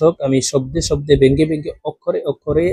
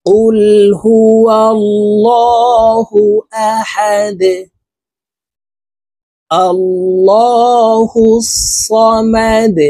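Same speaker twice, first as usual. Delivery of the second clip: slow, melodic, higher chanted recitation; Quran recitation; 7 semitones higher, 4 dB louder, much slower than usual. Acted. Teacher to viewers, reciting the whole sura in one go. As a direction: chant the sura slowly, stretching the vowels.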